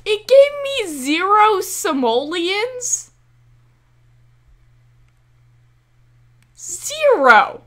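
can't believe the payout; high, stretched astonished question; outraged disbelief. Real, not acted. Reveal pays zero money.

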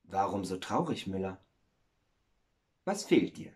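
soft, gentle friendly question. Acted. Water woman asks the sad miller what is wrong, friendly and soft. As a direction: ask softly, with kindly concern. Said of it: "fragte freundlich".

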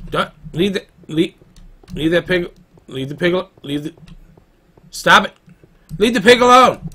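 shouting at the dog. Real, not acted. Gamer yelling at his dog to leave the pig. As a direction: yell at the dog to drop the pig.

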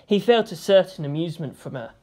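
ordinary delivery

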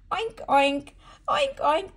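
high squeaky piggy oinks; oinking pig. Acted. Mother oinking like a pig for her toddler. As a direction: oink like a playful little pig. Animal sound game for toddler.

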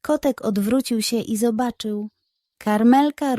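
ordinary delivery, nothing marked

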